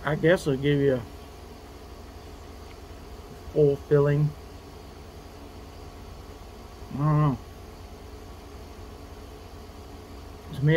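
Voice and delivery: eating a snack while speaking; talking while chewing. Real, not acted. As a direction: mouth partly full. Seen 0: Bit food just before; jaw moving, holds piece while talking.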